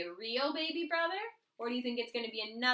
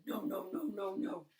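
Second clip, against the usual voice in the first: low gorilla refusal; 5 semitones lower, 4 dB quieter than usual. A narrator's reading. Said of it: "the gorilla said".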